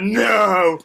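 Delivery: high, loud, drawn-out mock shout; retold shout of astonishment; disbelieving cry. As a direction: exclaim in amazed disbelief. Retold shock at recognising the show.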